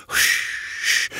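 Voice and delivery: soft unvoiced shushing; urgent hush. A narrator's reading. Muskrat breaking off to listen for Nag, hushing.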